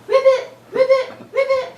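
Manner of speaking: high, punched puppet ribbits; Baby Ribbit. Pretend. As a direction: pipe up insistently as a tiny frog. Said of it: "Baby Ribbit's the one talking".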